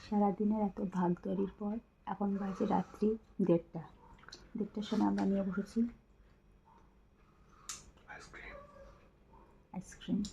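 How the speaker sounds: hushed late-night talk; speaking softly. Real, not acted. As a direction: keep your voice down, people sleep. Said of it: "আমরা এত আস্তে আস্তে কথা বলছি". (রাতে চাপা গলায় আস্তে কথা বলা)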